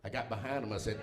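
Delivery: ordinary talk, nothing marked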